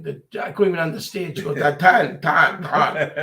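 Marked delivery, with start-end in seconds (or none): 1.6-3.1 louder, half-laughing mimic of the nurse saying ten; mocking how the nurse counted the blood tubes, with a laugh; imitating the nurse. Laughing mimic of nurse's counting.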